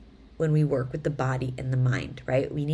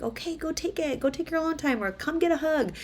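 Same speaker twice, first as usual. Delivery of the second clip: gentle mom; 12 semitones higher than usual. Retold or imitated. Mom answering her twins, a touch tender. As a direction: reassure the child kindly. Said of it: "I'm like".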